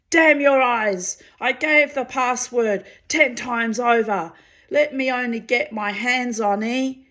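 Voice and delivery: high, loud furious roar; yelling with rage. A narrator's reading. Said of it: "yelled with rage".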